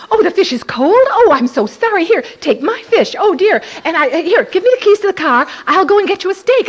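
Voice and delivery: high, loud, rushed fluster; high loud frantic people-pleaser; flustered eager apology. Retold or imitated. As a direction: scramble to fix it, all apologies. Her old flustered eager reply.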